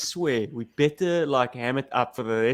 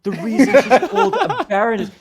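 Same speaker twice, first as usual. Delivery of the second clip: mock high voice; 9 semitones higher, 6 dB louder than usual. Mock voice feeding Paul's opinion.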